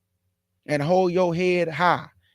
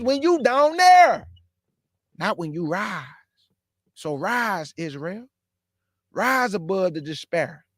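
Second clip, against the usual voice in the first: fervent preaching. Acted. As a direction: call and response preaching, rising on each "rise". Preaching call to rise.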